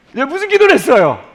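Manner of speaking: high, loud panicked denial; uneasy defensive yell. Acted. Preacher denying to God any such prayer, uneasy yell. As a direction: shout it back defensively. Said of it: "이때부터 불안해요".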